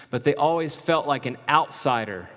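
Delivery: ordinary talk, nothing marked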